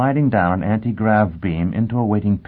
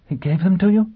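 shocked; 12 semitones higher than usual. A narrator's reading. Gisbella shocked.

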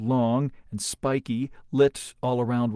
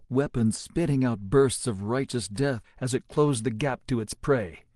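plain reading voice

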